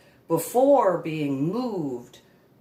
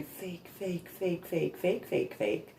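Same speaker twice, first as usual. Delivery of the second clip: soft, quiet repeated fake, fake; quiet chant of fake; whispered fake; 3 semitones higher, 8 dB quieter than usual. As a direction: murmur it like a voice in your head. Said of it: "a little fairy just going".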